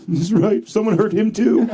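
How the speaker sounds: hammed-up emotional reaction; mock tearful. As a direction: choke up comically on the words. Sings [name] line that made him cry.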